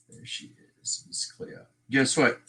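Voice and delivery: usual speaking voice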